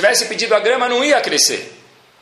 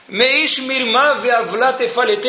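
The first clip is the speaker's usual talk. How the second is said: solemn verse recital; 6 semitones higher, much slower than usual. Acted.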